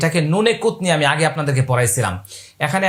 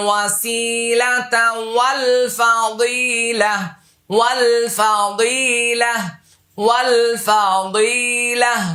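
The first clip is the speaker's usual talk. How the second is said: slow, higher, drawn-out chant; dua recitation; 6 semitones higher, much slower than usual. Shown as a demonstration. Teacher recites the dua to students, slow melodic chant. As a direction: intone the prayer, lengthening every vowel.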